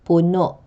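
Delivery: puno with stressed final -no, throat closing abruptly after it; acute glottal stress. Shown as a demonstration. Teacher repeating the example, puno stressed last with glottal catch. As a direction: land on the end of puno and cut it off. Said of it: "pronounced with a glottal catch".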